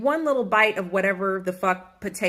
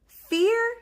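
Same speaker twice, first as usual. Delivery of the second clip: dramatic fear. Real, not acted.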